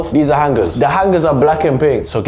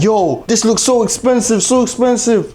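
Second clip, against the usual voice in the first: amazed; 7 semitones higher than usual. Retold or imitated. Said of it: "the first thing you think".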